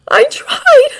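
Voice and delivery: a high, loud, raw rasp. Acted. Izuku admitting the failed escape, rasping. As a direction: rasp it out through tears. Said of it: "he rasps".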